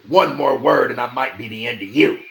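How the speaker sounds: loud, threatening retort; menacing retort. A narrator's reading. Bennis retorting to the old man, loud and menacing. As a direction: snap back with a threat. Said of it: "Bennis retorted".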